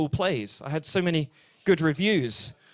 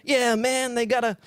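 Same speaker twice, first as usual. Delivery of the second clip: higher, hammed-up American street slang; mock American jive voice; 6 semitones higher than usual. Comic jive voice aimed at a heckler in the crowd. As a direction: play a cartoon American hipster, much higher. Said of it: "it's a crazy-ass jive".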